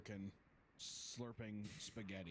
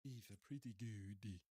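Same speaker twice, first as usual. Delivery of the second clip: slow, badly faked Italian accent; 2 semitones lower, slower than usual. Giant repeats his catchphrase, bad Italian accent. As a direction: linger on it in fake Italian.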